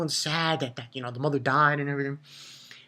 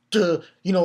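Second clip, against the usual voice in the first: ordinary talk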